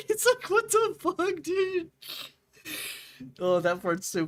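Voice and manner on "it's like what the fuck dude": much higher and louder, squealed; shrieked disbelief. Real, not acted. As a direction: shriek it through laughter. Laughing disbelief at a movie scene.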